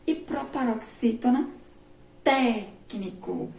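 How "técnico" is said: técnico drawn out, "téc" held and stressed; slow técnico, lean hard on "téc"; stress on first syllable. Shown as a demonstration. Teacher showing pupils a proparoxytone, "téc" stressed in técnico. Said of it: "é quando a antepenúltima é a mais forte".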